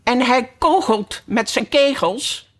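lightly voiced high, shrill little flower; a hint of small piping voice; another daisy calling. A narrator's reading. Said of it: "riep een andere Margriet".

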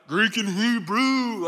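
drawn-out, mock know-it-all answer; mimicking eager dudes. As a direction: stretch it out like the guys in the front row. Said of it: "the dudes up in the front are like".

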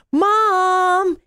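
put-on a boy shouting with delight; excited yell. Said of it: "he yelled with excitement".

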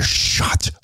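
hushed unvoiced whisper; whisper in his ear. Whispering to his friend in the cop's car, hushed whisper. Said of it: "I say to his ear".

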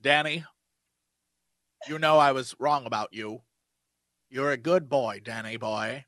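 low, soft mocking fatherly tone. Mocking the show's ending to friends, low and smug. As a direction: parody a smug reconciliation. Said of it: "the end of the show was like".

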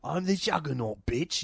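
lightly put-on tough-guy impression, softly delivered; Vinnie Jones. Podcaster quoting the actor's famous line, Vinnie Jones impression. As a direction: imitate a Cockney hard man without much volume. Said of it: "you think of his voice first".

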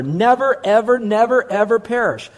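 insistent repeated Never, ever; emphatic never, ever. Shown as a demonstration. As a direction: pound never, ever, never, ever home. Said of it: "underline the word never".

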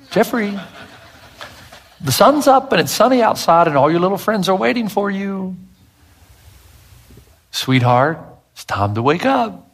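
high, gently coaxing mother's call; mom waking her boy. Retold or imitated. Mother waking her little boy, high and coaxing. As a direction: call him sweetly like a mom at the bedroom door. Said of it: "I can hear my mom doing this".

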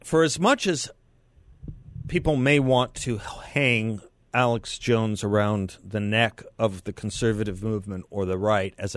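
slow and deliberate, with weighty pauses; unhurried oratorical delivery with long gaps; slow, careful restatement. Real, not acted. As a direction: say it measured, phrase by phrase, landing the key words. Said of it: "I said it too quickly".

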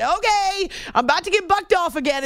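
high-pitched mock-alarmed "uh-oh" thought; retold alarm. Rider telling herself she's about to be bucked off, mock alarm. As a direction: voice your rising alarm in retelling. Retelling her alarm on a bucking horse.

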